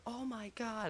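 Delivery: very high-pitched mock exasperation; put-on high voice, scandalized; outraged girl imitation. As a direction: mimic a girl's appalled squeal. Retold girl outraged by the insult.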